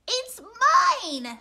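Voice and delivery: high, loud possessive shout; excited shout. A narrator's reading. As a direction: shout it high, claiming the prize. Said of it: "shouted Cyril".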